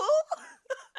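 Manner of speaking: high burst of laughter; loud laugh. Real, not acted. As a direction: break into a big laugh. Laughs at own remark.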